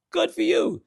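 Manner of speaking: squeaky falsetto mock praise; falsetto good for you. Comic cheering on a hacky comedian in falsetto. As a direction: jump way up into a falsetto.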